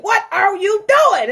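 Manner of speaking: high, loud, drawn-out scolding; nagging heart. Retold or imitated. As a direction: demand it like an exasperated parent. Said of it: "My heart is constantly like".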